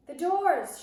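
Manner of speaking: high, loud shout that fills a hall; commanding witch shout echoing round the room; booming witch shout. Acted. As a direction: bellow it so it bounces off the walls. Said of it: "shouted the Grand High Witch in a voice that filled the room".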